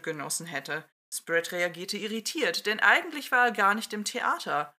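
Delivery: lively read-aloud narration with character voices; story narration. A narrator's reading. Podcast host reading a fan story aloud, lively narration. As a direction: tell the fan story to your listeners.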